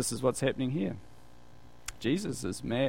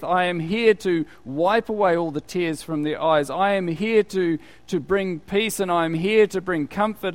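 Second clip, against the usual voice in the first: louder, higher, emphatic declaration; angry resolve; 5 semitones higher, 4 dB louder than usual. Retold or imitated. Jesus to himself at the mourners' grief, angry resolve. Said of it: "he's angry".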